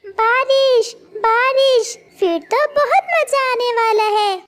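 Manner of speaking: put-on a child delighted that rain is coming; excited chick. Chick to his mother about the rain, excited child's voice.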